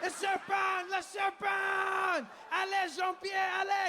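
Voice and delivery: high, hammed-up French panic with stretched endings; panicked Frenchman. French father fleeing a snake, mock panicked French shout. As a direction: cry out as a mock-terrified French dad. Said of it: "The French guy was my favorite".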